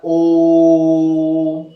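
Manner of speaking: ओम held out very long, like a chant; extra-long vowel. Shown as a demonstration. Teacher showing it outlasts a long vowel, extra-long. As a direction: sustain ओम on one steady note. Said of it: "इनके उच्चारण में अधिक समय लग रहा है".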